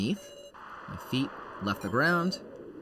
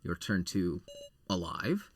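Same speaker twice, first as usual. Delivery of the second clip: ordinary delivery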